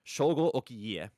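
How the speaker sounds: Shogo Okiie said slowly with a long O in Shogo and every vowel of Okiie sounded; slow teacher model of Shogo Okiie; careful full name. Shown as a demonstration. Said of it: "This pronunciation is".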